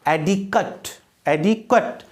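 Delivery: "एडिक्वेट" with schwa before the final T; adjective "-ate" pronunciation. Shown as a demonstration. Teacher models an adjective for students, "एडिक्वेट" ending "-kut". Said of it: "इसको क्या बोलेंगे?".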